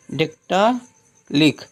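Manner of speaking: "डेक्टालिक" slowly, long "dektaa", pause, short "lik"; syllable-by-syllable. Shown as a demonstration. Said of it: "ली बोलिए फिर का बोलिए".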